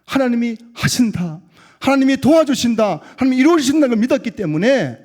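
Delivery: loud, high sermon declaration; impassioned pulpit cry; full-force preaching. Real, not acted. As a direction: proclaim it to the congregation with rising force.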